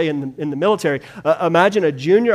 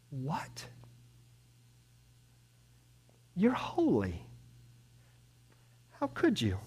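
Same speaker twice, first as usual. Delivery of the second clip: bewildered; 12 dB quieter, slower than usual. Acted. Habakkuk baffled, questioning God.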